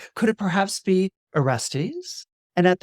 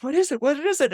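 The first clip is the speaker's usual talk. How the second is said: alarmed question; 10 semitones higher, faster than usual. Acted. Electra alarmed at brother's fit.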